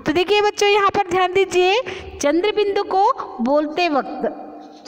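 usual speaking voice

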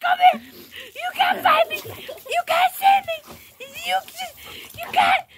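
high-pitched warrior dog voice; playful taunting. Pretend. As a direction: taunt them in a high play voice. Voicing a taunting dog in chase.

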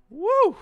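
high, loud cheer; hyping the chat up with a cheer; excited woo. Real, not acted. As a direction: whoop to hype the song. Cheering before next song.

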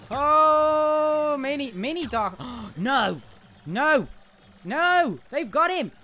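high frantic cries of no; panicking gamer. Real, not acted. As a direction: shriek in alarm. Teammate captured in game.

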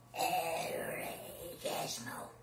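soft, voiceless throaty creature rasp; Gremlins. Acted. Showing the prank-call voice, Gremlin rasp. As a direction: rasp it quietly like a little monster. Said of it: "Io imitavo la voce dei Gremlins".

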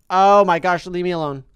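ordinary delivery

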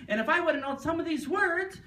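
her rant climbing high at the end; imitating a frustrated woman venting; mock outburst. Attendee complaining to the group, high mock rant. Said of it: "She says".

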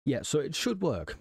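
should hit hardest, falling after it; wry, doubtful weight on should; stress on should. Shown as a demonstration. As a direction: land on should like you doubt it. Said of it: "Emphasis on should".

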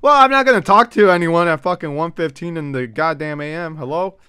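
high, loud ranting protest; mock indignation. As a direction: protest loudly as if outraged. Mock indignation, answering a phrase.

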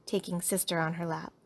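ordinary delivery

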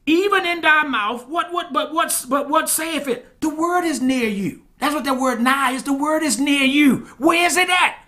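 high, driving sermon voice; impassioned preaching. Real, not acted. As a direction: preach it loud and high, pressing every clause home.

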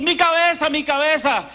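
put-on high yelled cry of a boy in pain; child crying out. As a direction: shout it like a boy with a headache. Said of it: "el niño comienza a pegar gritos". (niño gritando de dolor)

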